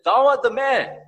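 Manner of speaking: loud, high-pitched retold accusation; prophet's accusation. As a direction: proclaim it as a prophet accusing a king. Acted accusation by Nathan the prophet.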